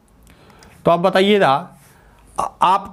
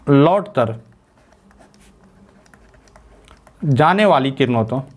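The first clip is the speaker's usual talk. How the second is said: dictation; 3 semitones lower than usual. Shown as a demonstration. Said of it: "तो लिख लीजिए".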